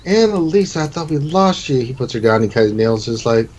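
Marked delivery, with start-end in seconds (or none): 0.0-1.8 high start, easing down; acted emotional reunion; relieved uncle. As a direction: cry the name out with relief.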